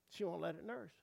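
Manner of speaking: ordinary talk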